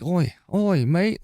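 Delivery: high, hammed-up cockney British greeting; a jokey fake British accent; mock British accent. Imitating girls greeting a British streamer in mock British accent.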